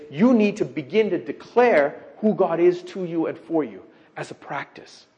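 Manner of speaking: normal talking voice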